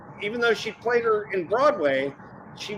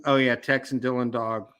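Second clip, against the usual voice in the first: ordinary talk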